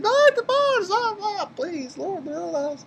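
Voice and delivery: imitating a frightened girl pleading. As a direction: beg high and soft for it to stop. Elizabeth begging the fight stop.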